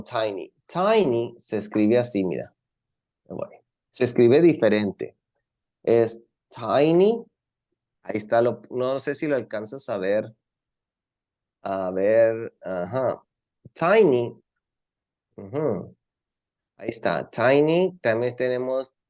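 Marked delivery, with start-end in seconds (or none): none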